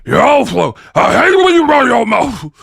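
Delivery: wag a finger like a menacing clown; Pennywise impression. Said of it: "I can't even get the impersonation".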